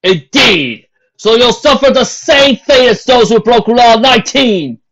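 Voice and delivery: loud, high, slow proclamation; stern captain. A narrator's reading. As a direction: lay down the law to the crowd. Captain pronounces fate.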